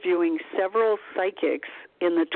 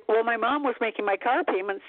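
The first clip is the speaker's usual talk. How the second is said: usual speaking voice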